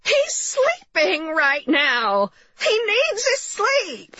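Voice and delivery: high, slow wailing bellow. A narrator's reading. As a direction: bellow it drawn out in a high mother's voice.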